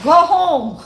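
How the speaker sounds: high, loud mock yell at the staff; imitated impatient shout. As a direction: holler it, imitating a fed-up old man. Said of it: "he be like".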